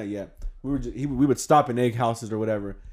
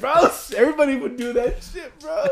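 laughing insist; 12 semitones higher, 3 dB louder than usual. Retold or imitated.

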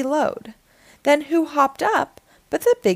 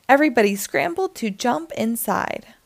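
ordinary delivery

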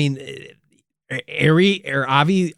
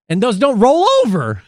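indignant outburst; 9 semitones higher, 3 dB louder than usual. Acted. Mock outrage about minutes.

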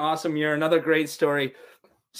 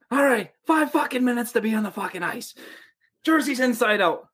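high, hurried coach yelling orders; mock coach bark; 7 semitones higher than usual. Coach hurrying the team onto the ice, mock yell.

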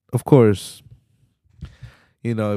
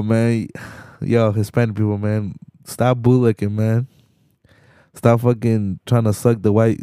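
ordinary delivery

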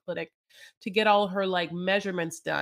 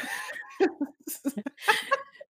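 airy laugh after the joke; high breathy laugh; 13 semitones higher than usual. Real, not acted. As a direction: burst out laughing at your own bit. Laughing at own impression.

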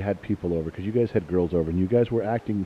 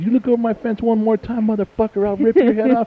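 much higher, louder mock-menacing threat; retold threat; 13 semitones higher, 4 dB louder than usual. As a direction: bark the threat at the neighbour like he's there.

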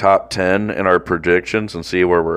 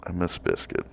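softer, mopey mock lament; mock homesick; 5 semitones lower, 8 dB quieter than usual. Host pining for his cat, mock sad and soft. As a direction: whine it like a homesick kid.

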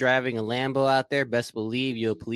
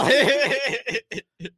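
high, loud villain cackle; evil cackle; 12 semitones higher, 3 dB louder than usual. Pretend. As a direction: cackle like a cartoon baddie. Villain bit continues.

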